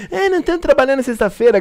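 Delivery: high mocking imitation of viewers. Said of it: "O pessoal pode falar assim". (voz debochada imitando o ouvinte)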